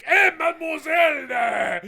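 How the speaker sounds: put-on loud, high drunk Frenchman calling out; hammed-up French drunk catcall; french chef. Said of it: "he'd be like".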